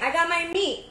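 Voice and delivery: normal talking voice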